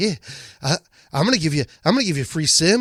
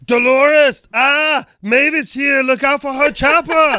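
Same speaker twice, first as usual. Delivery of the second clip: loud, higher Austrian bark; Arnold Schwarzenegger impression; 8 semitones higher, 6 dB louder, slower than usual. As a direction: shout it like Schwarzenegger. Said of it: "Arnold Schwarzenegger".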